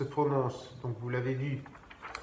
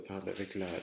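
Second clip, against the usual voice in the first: usual speaking voice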